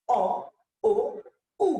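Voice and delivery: অ, ও, উ one by one, lips progressively rounder; isolated vowels from open "aw" through "oh" to "oo"; rounded back vowels. Shown as a demonstration. Teacher showing how lips get round, অ, ও, উ as separate rounded vowels. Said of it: "ঠোঁটটা গোলাকার হয়ে যাবে".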